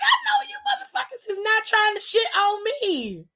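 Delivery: laughing shout. Retold or imitated. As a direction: shriek it through laughter. Laughing shout in retold reply.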